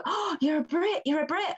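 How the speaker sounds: high-pitched gushing over a Brit; squealy imitation of an excited stranger; mock excited gushing. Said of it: "and people".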